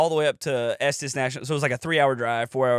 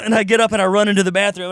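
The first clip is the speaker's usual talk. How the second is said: usual speaking voice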